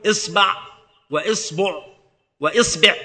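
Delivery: إصبع with kasra on the hamza, ba with fatha, damma, kasra; vowel variants. Shown as a demonstration. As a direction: say إصبع three ways, isbaʕ, isbuʕ, isbiʕ. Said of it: "ويجوز في الباء ثلاثة أوجه".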